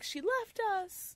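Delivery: high, drawn-out lament; mock panic. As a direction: wail it slowly, hamming it up. Acting out characters' dramatic panic.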